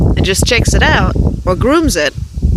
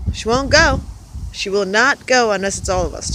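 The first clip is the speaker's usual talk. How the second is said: usual speaking voice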